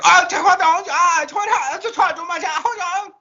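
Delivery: high, loud frantic gibberish rant; imitating a guide losing his mind; mock snapping outburst. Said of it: "he immediately snaps".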